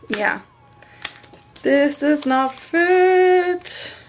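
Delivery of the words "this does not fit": slow, high, drawn-out complaint; a stretched, sing-song gripe at the sticker; exasperated. Real, not acted. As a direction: whine it out slowly in mock despair. Frustrated sticker doesn't fit.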